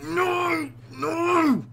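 sudden high, full-volume scream; anguished scream. Real, not acted. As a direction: scream as if you lost it all. Screams at failing in game.

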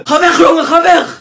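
high, loud yelling; angry shout. Acted. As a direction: chase him out yelling. Parent throwing kid out.

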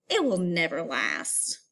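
slow, low, head-shaking dismissal; doubtful father. Acted. Father doubting his son's baseball dream, slow and low. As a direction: say it like a father who has no hope for it. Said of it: "shaking his head".

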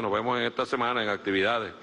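ordinary talk, nothing marked

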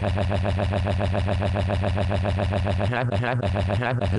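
low breathy laugh for the machine sound; laughing tardis noise. Acted. Time machine noise while he's inside it, low breathy laugh. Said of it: "and it sounds like".

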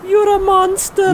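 high put-on woman's cry; horrified girlfriend. [name] shouting at Steve, horrified. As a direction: accuse him like a frightened girlfriend.

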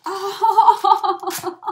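loud laugh breaking into oh; burst of laughter. Real, not acted. Opening a friend's gift, bursting out laughing.

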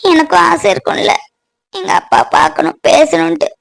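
weeping alone, pleading and aching; tearful lament. Acted. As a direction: pour out the heartache through tears. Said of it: "பொண்ணு அழுதுட்டு உட்காந்துருக்கா".

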